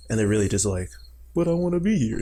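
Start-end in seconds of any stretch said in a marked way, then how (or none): 1.3-2.2 put-on high, childish little protest; mock whining. Ghost told to leave the house, mock whining. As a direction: plead lightly like a put-out ghost.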